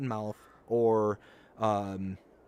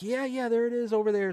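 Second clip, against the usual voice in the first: higher imitation; 10 semitones higher than usual. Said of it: "And she's like".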